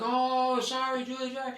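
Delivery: high-pitched, softened pleading imitation; mock anxious apology. Brother replays his apology to sister, pleading. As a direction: placate a hurt little sister.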